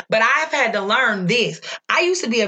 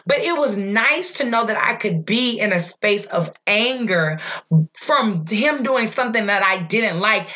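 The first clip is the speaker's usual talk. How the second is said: usual speaking voice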